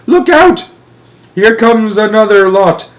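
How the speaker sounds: very high, loud alarm; shouted warning. A narrator's reading. Astronaut seeing another attack, shouting. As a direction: yell for cover. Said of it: "shouted Shuckworth".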